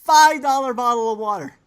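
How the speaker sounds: high, loud incredulous repeat; outraged emphasis. Real, not acted. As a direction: drive the shocking price home. Repeats outrageous price after listener's shock.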